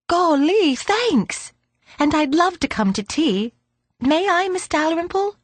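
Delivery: put-on high, eager child's voice; excited young girl. A narrator's reading. Belinda accepting a gift and tea invitation, excited young girl. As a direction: squeal thanks like a delighted little girl.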